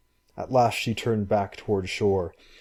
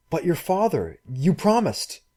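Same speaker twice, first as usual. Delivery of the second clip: put-on higher, lighter young girl; girl's voice; 8 semitones higher than usual. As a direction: voice the girl, anxious. Girl's voice in story.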